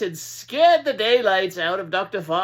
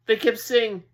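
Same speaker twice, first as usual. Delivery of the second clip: ordinary delivery, nothing marked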